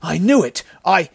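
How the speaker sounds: high, loud, alarmed; panicky outburst. A narrator's reading. Bandit interrupting the survivor, panicky. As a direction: burst in and get cut off.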